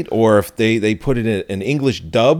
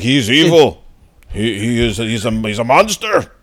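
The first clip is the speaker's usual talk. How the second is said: mock denunciation; 4 dB louder than usual. Priest warning the cops about the tall man, mock denunciation. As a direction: denounce him like a hammed-up horror priest. Acting out the priest denouncing a monster.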